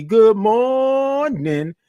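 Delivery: high, long-held MORNING greeting; drawn-out morning. Real, not acted. Host greeting viewers by name, morning drawn out. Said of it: "like all those O's and R's".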